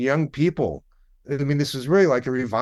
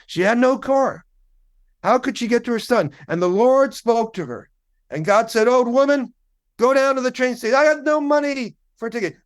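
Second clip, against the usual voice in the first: high, impassioned gospel testifying, imitated; pitched-up revival storyteller, drawing out the phrases; gospel singer storytelling; 8 semitones higher than usual. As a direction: tell it like a gospel singer working up the crowd. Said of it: "begins with these three gospel singers".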